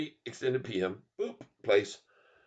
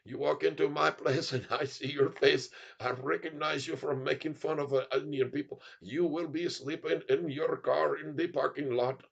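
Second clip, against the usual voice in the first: put-on threatening hotelier with Indian accent; 2 semitones higher than usual. Hotel owner refuses a mocker a room, put-on Indian accent. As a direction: turn him away in a mock Indian accent. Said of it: "if they see you talking like this".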